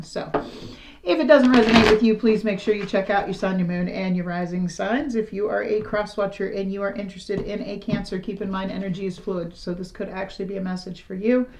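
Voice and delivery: usual speaking voice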